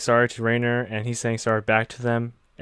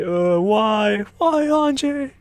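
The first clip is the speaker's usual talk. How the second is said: much higher mock wail; mock crying; 10 semitones higher, much slower than usual. Crew watching their commander explode, much higher mock wail. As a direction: pretend to sob out the question. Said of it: "everyone's really crying".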